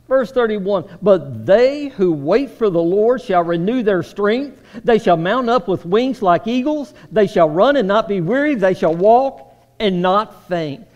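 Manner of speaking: loud, ringing scripture reading from the pulpit; preaching scripture. Acted. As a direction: proclaim the verse to the whole church. Reads scripture with full force.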